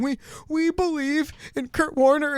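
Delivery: high, slow imitation of crying; mock tearful. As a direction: imitate a coach choking up at a press conference. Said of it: "he's literally in tears".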